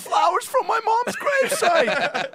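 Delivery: high, loud strained voice, laughing; Super Dave voice. Retold or imitated. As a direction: squeeze it out high through a laugh.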